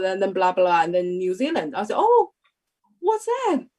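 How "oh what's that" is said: much higher, excited exclaim; excited surprise. Retold or imitated. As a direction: retell the thrill of hearing her own country named. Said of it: "So I was very excited".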